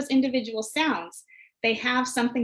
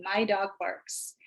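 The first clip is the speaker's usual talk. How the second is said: slow, softer echo with the class; slow choral repeat; 2 semitones lower, 4 dB quieter, slower than usual. Shown as a demonstration. Teacher repeating with students, slow soft echo. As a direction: repeat it slowly along with the kids. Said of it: "have the students repeat the sentence with you".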